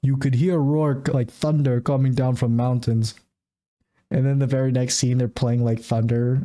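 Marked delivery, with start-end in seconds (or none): 0.0-3.1 mock heavy Russian accent. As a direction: do the hunter father's Russian accent. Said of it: "his father's a heavy Russian accent".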